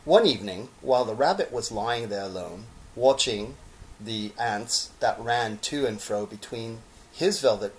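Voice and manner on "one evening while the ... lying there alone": ordinary delivery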